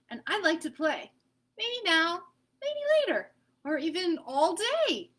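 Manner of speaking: lightly voiced eager child asking to play; eager kid voice. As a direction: pitch it up like a keen little kid. Peanut Butter greets Cupcake.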